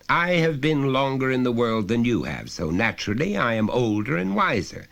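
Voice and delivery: high, loud, lecturing father voice; a father talking down to his son, patiently insistent; stern father. Acted. Father pressing his small son, loud and high.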